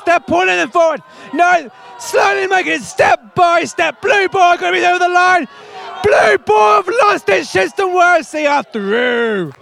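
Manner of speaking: high, loud sports commentary; excited play-by-play. Real, not acted. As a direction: shout the contest home to the finish. Live tug of war commentary.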